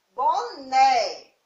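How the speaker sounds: "boné" slowly, lingering on the open final é; slow "boné" showing its open é; stressed open syllable. Shown as a demonstration. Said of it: "porque o som ficou aberto".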